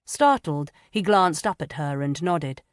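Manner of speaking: usual speaking voice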